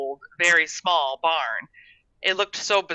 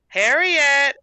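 loud, high call; 10 semitones higher, 8 dB louder than usual. A narrator's reading. Woman calls Harriet from the school steps, calling out. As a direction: call a child in from a distance. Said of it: "a woman's voice called from the steps".

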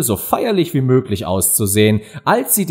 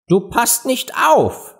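high, slow scolding mouse; stern; 11 semitones higher, slower than usual. A narrator's reading. Mouse scolds Alice for not listening, stern. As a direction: reprimand in a high, deliberate voice. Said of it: "sagte die Maus streng".

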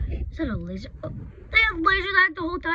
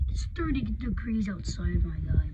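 ordinary talk, nothing marked

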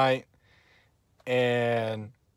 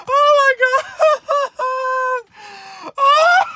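much higher, loud shrieking taunts broken by laughter; gloating taunt; 6 dB louder than usual. Real, not acted. Tennessee fan taunting Alabama fans, shrieking gleeful gloating. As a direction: rub it in their faces, squealing with glee.

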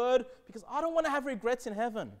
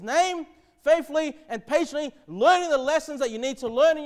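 higher and much louder, driving the point; full-force preaching; 5 semitones higher, 8 dB louder than usual. Acted. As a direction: preach it hard to the congregation.

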